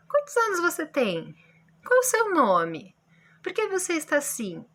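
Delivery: demonstrating how questions should sound; question intonation. Shown as a demonstration. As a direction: ask it with real curiosity. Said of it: "tenho que dar uma entonação de dúvida, de pergunta".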